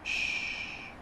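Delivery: soft unvoiced shush; whispered shush. Real, not acted. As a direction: hush the dog gently. Hushing the dog.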